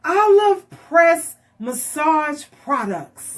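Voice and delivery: put-on child slowly announcing the future shop name; slow proud reading. Child reads imagined sign.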